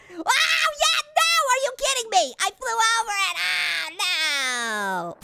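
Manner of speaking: high yell falling into a drawn-out wail; exasperated outcry. Real, not acted. Player flies over the blimp, exasperated wail. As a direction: cry out in disbelief, sinking to a moan.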